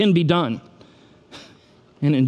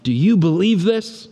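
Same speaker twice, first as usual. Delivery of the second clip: raised-pitch, insistent challenge; impassioned preaching; 4 semitones higher than usual. Real, not acted. Preacher challenging the congregation to believe, impassioned. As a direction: put the question forcefully to the crowd.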